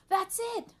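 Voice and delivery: high, loud excited cry; screaming. A narrator's reading. Arthur spotting the treasure in the game, screaming. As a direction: shriek with discovery. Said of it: "screamed Arthur".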